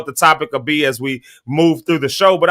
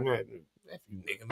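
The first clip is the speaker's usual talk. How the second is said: fed-up muttering; 7 semitones lower, 21 dB quieter than usual. Acted. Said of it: "get me to the point where".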